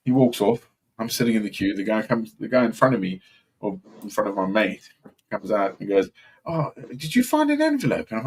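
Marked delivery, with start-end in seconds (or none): 6.5-8.1 a high, hopeful asking voice; high eager asking. Retold or imitated. A man in the queue asking about his envelope, high and hopeful. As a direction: ask high, hoping he found it.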